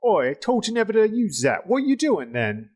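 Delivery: high-pitched, hammed-up Cockney-Kiwi scolding; comic high Cockney slash Kiwi character voice; Cockney-New Zealand accent. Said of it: "they hear this Cockney slash New Zealand accented person".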